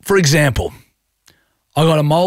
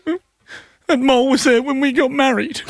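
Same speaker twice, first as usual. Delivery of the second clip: high-pitched tearful imitation of a pregnant wife; mock emotional wife; 11 semitones higher than usual. Husband mimicking his wife over the removed mole, tearfully high. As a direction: wail it much higher, as if hurt. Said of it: "I'm just getting emotional about that".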